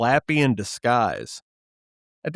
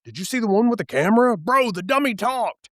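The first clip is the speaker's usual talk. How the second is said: put-on excited kid; 7 semitones higher, faster than usual. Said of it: "We talk about it Monday like breaking news".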